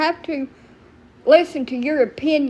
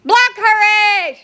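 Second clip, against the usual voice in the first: loud, high, slow shout to block her; yelled block order; 8 semitones higher, 6 dB louder, slower than usual. Real, not acted. Streamer yelling to her mod to block a viewer, loud and drawn out. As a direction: bark the order across the room.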